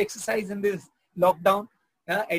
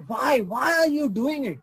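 exasperated why; 7 semitones higher, 4 dB louder than usual. Retold or imitated. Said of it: "it's like".

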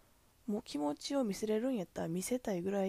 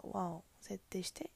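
ordinary talk

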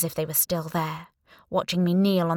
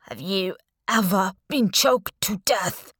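gurgling; 3 dB louder than usual. A narrator's reading. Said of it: "gurgled Ginger Bun".

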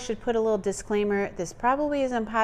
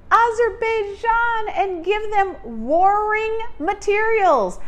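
slow, loud, high indignant questions; outraged; 9 semitones higher, 5 dB louder, slower than usual. Real, not acted. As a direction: hammer each question out. Rising outrage over bombing.

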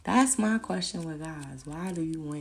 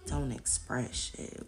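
ordinary talk

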